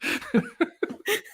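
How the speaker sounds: helpless high-pitched laugh; hard laughter. Real, not acted. Cracked up by an impression of a radio host, laughing hard. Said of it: "you got me laughing so hard".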